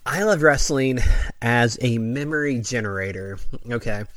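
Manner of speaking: ordinary talk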